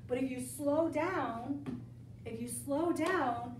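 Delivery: slow it right down, word by word; slow emphatic repeat. Shown as a demonstration. Teacher urging her class to slow down, said slowly. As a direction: lean on each word of slow down, drawn out. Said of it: "I cannot emphasize this enough".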